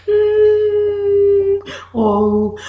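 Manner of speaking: high, loud pretend whimper; mock whimper. Shown as a demonstration. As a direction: whimper like a crying child. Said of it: "you cry".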